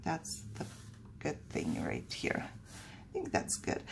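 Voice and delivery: whispering to herself; whispered. Real, not acted. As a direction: breathe it under your breath while working. Whispers while working.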